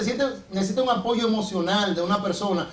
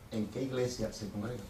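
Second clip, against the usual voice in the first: stern pastor; 11 semitones lower, 13 dB quieter than usual. Retold or imitated. Pastor repeats the question.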